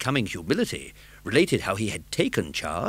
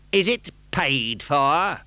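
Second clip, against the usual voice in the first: raised, slow insinuation; pouncing question; 8 semitones higher, 5 dB louder, much slower than usual. Acted. Magistrate accusing the old gentleman, sly and slow. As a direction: pounce on the point.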